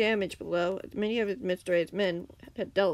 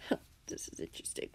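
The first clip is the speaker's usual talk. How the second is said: villain voice; 11 dB quieter, faster than usual. Acted. Villain amused by shield.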